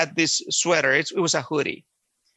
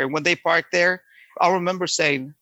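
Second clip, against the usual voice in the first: normal talking voice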